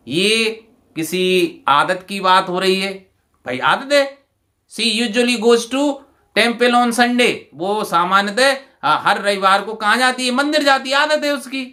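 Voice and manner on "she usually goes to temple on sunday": slow example reading. Shown as a demonstration. Teacher reads example sentence.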